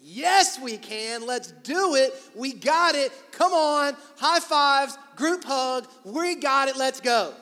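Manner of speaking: loud, high rallying shouts; exclamation-point enthusiasm. Acted. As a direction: whip the crowd up like a hype man. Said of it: "the exclamation point people say".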